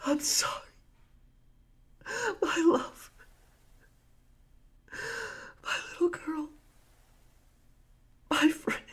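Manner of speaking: soft, high broken sobbing; grieving murmur. Acted. Pirate mourning his wife, daughter and friend, quiet sobbing. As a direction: breathe out each name through tears.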